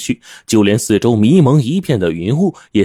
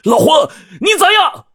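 very high, strained call down a cliff; acted desperate yell to someone far below; frantic calling out. A narrator's reading. Anxious narrator calling Old Huang at the cliff bottom, frantic high yell. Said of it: "我急得冲崖底扯破喉咙大叫".

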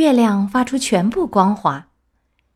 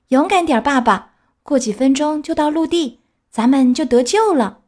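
raised, bright reassurance; comforting; 6 semitones higher, 3 dB louder than usual. A narrator's reading. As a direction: cheer him on, louder. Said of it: "安慰他说".

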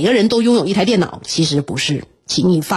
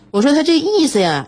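normal talking voice

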